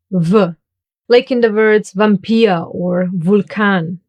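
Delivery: V, vampire and Vulkan with a voiced V sound; vampire and Vulkan, slow, buzzing V; German V as W. Shown as a demonstration. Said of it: "pronounced like the German W".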